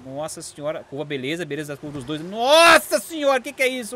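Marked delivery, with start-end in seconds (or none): 2.2-3.9 high, drawn-out astonishment; amazed exclamation. Real, not acted. Commentator watching both cars take the curve, amazed cry.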